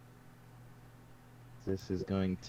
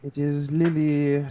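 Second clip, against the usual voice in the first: slow, slightly slurred tipsy voice; 6 semitones higher, 6 dB louder than usual. Acted. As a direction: sound a bit drunk. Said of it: "I'm trying to sound like I'm a bit tipsy".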